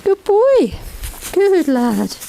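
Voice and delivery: delighted praise to a horse that obeyed; high praise coo. Real, not acted.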